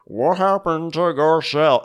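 slurred, slow mock Tom Brokaw accent. Harry Hamlin asking about Garcelle, Tom Brokaw accent. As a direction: slur it slowly in a bad Brokaw accent. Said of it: "in his Tom Brokaw accent".

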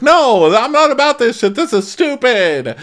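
very high, loud first word, dragged ending; mock exasperation. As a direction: imitate a fed-up man's outburst. Chris upset after the fight, acted.

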